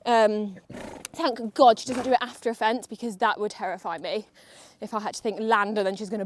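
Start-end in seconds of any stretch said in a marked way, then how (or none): none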